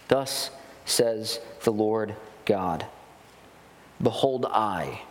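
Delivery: low, measured Bible reading; solemn scripture read from the pulpit. Acted. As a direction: read the verses slowly, with weight. Reads scripture passage aloud.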